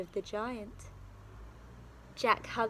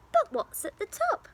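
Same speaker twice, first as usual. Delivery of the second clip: put-on eager boy voice; 13 semitones higher, 4 dB louder than usual. Jack wonders excitedly.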